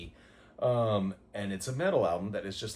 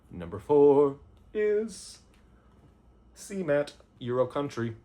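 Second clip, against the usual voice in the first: drawn out, louder, slow announcer reveal; dramatic countdown; 3 semitones higher, 8 dB louder, slower than usual. Pretend. Host unveils number four to viewers, slow louder drumroll voice. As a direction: build suspense like a game show host.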